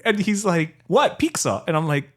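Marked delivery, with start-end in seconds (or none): 0.9-1.6 high "What", then "pizza" as "peek-sa"; puzzled, K in "pizza"; Jose's mispronunciation. Retold or imitated. Jose not hearing his error, mispronounced. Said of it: "do you not hear it".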